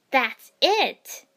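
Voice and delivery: loud, high outburst; shout. A narrator's reading. Ino jumping out at her taunting rival, shouting. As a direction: explode at the taunts. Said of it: "Ino shouted".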